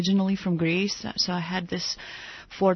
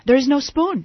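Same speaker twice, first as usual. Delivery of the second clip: blurts it out high and loud in sudden excitement; the client bursting in with his big discovery; excited exclamation; 6 semitones higher, 6 dB louder than usual. Retold or imitated. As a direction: announce a revelation with a jolt of energy. Client excitedly repeating film line.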